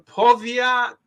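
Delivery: "Povia" sounded out slowly and high; clumsy Polish attempt. Real, not acted. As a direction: try "Povia" slowly, as a non-Polish speaker. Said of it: "I'm not Polish".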